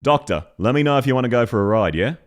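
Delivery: quick, even, natural Australian delivery; Australian pronunciation. Shown as a demonstration. Showing how we'd pronounce it, flat Australian accent. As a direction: say it flat and run together, in Australian. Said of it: "that's more how we'd pronounce it".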